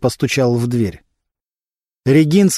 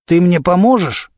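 pleading question, pitched up; 5 semitones higher than usual. A narrator's reading. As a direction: ask it as a mild plea for help. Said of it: "умоляюще посмотрел на Константина".

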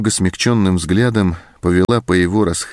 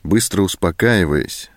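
ordinary delivery, nothing marked

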